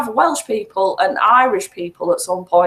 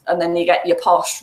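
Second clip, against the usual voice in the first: ordinary talk, nothing marked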